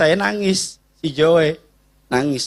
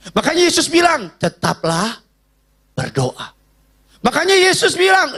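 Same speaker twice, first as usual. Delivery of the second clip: sermon climb; 11 semitones higher, 3 dB louder than usual. Acted. Preaching at full force.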